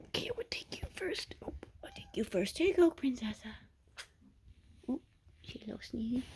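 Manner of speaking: unvoiced whisper while handling the kittens. Real, not acted. Whispering to each kitten while carrying it back. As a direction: keep it hushed as you pick them up.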